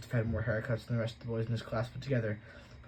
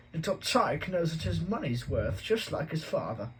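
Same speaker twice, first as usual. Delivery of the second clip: Uncle Vernon chuckling; 6 semitones higher, slower than usual. A narrator's reading. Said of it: "Uncle Vernon chuckled".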